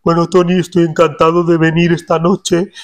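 formal royal greeting to the host Tony; King Felipe impression. Shown as a demonstration. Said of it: "si empiezas a hablar como el rey Felipe".

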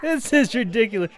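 talking through real laughter; words bubbling out mid-laugh. Real, not acted. Gamer amused by absurd game voices, laughing.

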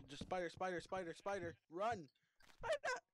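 loud, high panicked shouts about a spider; panicked yelling. Real, not acted. Gamer spots a spider and warns his friend, panicked shouting. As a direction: yell a frantic warning, voice jumping up.

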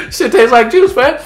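high, loud, laughing amazement; delighted surprise. Acted. Drinker surprised it tastes like juice, laughing delight. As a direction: crack up as the taste surprises you.

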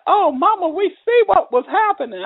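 put-on grown children exclaiming. Grown children telling mama they see it now, lightly mimicked.

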